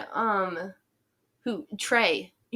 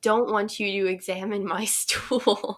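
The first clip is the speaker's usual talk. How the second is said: playful protest breaking into laughter; laughing through a pretend telling-off; mock-indignant plea; 2 semitones higher than usual. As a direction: plead in mock outrage, cracking up by the end.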